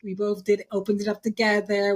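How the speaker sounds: ordinary talk, nothing marked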